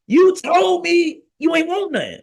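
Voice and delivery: high-pitched mock protest; incredulous comeback. Husband defending himself to his wife, incredulous. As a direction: throw her own words back, high and incredulous. Retold food argument with wife.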